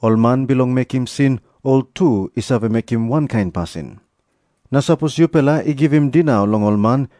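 plain reading voice

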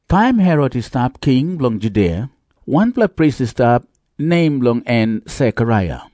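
ordinary talk

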